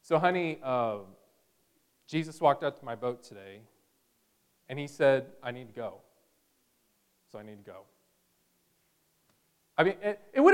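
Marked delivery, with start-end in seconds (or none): 0.0-7.8 lower, softer, sheepish confession to his wife; hushed husband trailing off; husband telling his wife. Pretend. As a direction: break the news gently, fading out. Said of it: "hugging his wife and saying".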